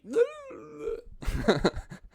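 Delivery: high-pitched thin imitated wail; imitated drowning noise. Older brother mimicking Hank in the water, high wail. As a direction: mimic a struggling kid's high cry. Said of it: "I could hear Hank".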